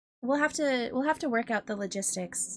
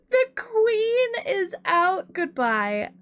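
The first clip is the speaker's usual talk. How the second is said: high, slow, fake-sobbing exit line; mock crying diva; 9 semitones higher, 4 dB louder, much slower than usual. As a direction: wail it like a tearful queen. Streamer copies guest's exit line.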